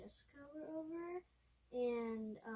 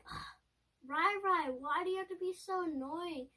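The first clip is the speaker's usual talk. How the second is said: annoyed whine; 6 semitones higher, 9 dB louder, slower than usual. Retold or imitated. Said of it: "and I was like".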